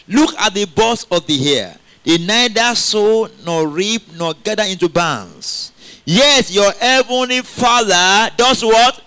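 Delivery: slow, loud Bible reading; scripture reading. Acted. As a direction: read the verse out slowly, with weight. Preacher reading scripture slowly.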